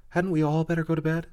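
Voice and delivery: put-on high, quick small-girl voice; the youngest sister pleading to end the squabble, high and quick; little Lucy. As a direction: ask it nervously like the youngest.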